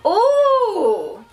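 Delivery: delighted gasp. Real, not acted. Finds the foundation she wanted, gasps laughing.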